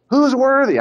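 high, booming cry of an angel; loud authoritative call. Acted. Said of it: "he says in a loud voice, authoritative".

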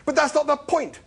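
loud, very high-pitched protest. A narrator's reading. Arthur arguing with Zaphod, protesting. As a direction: object loudly, voice shooting up. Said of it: "protested Arthur".

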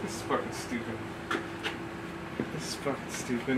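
very soft muttered complaint; under-breath mutter. Retold or imitated. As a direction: grumble it quietly to yourself. Recruit muttering head down.

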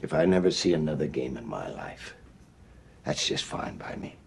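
low, weary disgust; halftime pep speech. Real, not acted. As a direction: sink into despair. Coach deflated, low.

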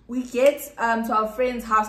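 ordinary talk, nothing marked

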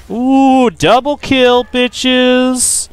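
gleeful cheer. Real, not acted. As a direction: gloat over the double kill. Celebrating double kill.

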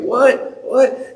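put-on high, puzzled teen voice; groggy son. Son answering his dad from downstairs, high mock groggy. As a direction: play the sleepy boy. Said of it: "he's like".

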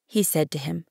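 ordinary delivery, nothing marked

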